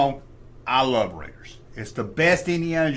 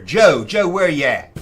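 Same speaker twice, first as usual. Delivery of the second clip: calling out; 7 dB louder than usual. Pretend. Calling out absent friend.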